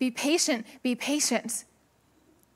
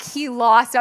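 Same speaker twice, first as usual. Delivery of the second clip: ordinary delivery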